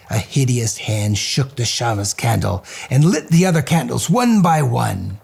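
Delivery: usual speaking voice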